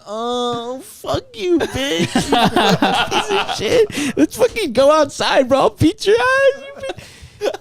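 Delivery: very high, tearful squeal of threats; hammed-up sobbing tough talk, breaking into laughter; mock crying. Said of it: "could you imagine if I started crying".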